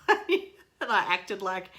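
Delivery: giggling through the words; laughing. Real, not acted. Vlogger laughing at her own salt video, giggling. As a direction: laugh while recalling it.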